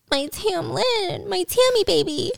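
high, soft pining whimper, hammed up; mock moping. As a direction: pine slowly for a lost lover. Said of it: "but she's moping around".

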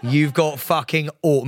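ordinary talk

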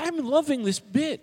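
high, loud, slow gloating; gleeful. Pretend. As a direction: gloat over it with glee. Taunting cohost.

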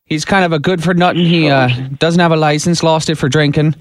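put-on a light Irish accent on a prank call. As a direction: play an Irish caller hunting for his brother-in-law. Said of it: "Jim is gonna do his best Irish accent".

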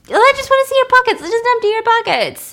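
mock the pushy captain in a silly voice; squeaky hammed-up cop insisting. Captain mockingly mimicked demanding.

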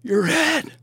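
sudden high yelp of alarm; alarmed warning. Retold or imitated. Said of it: "and I'm like".

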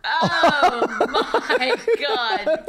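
high, loud gasp of laughter; shocked laughter. Real, not acted. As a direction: burst out laughing in appalled delight. Reacts to a gross picture.

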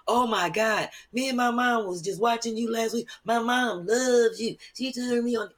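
high-pitched gushing fan, imitated; excited fans. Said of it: "then they be like".

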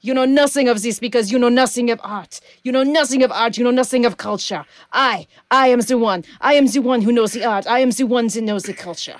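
put-on imperious thief in a Franco-Russian accent; Melinda LaFleur. Sinister art connoisseur scorning her captive, muddled Russian-French accent. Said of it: "see, she's turning Russian".

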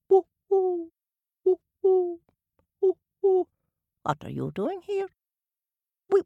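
high hooting owl voice. Acted. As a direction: speak as a wise old owl. Owl character speaking.

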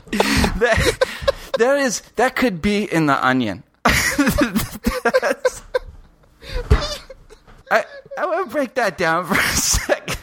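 high-pitched, helpless laughing talk; cracking up while talking. Real, not acted. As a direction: squeeze the words out through real laughter. Can barely speak for laughing.

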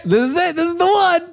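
high frantic cry of panic; doomsday panic. Pretend. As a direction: shriek it like the end is here. Joins the doomsday bit.

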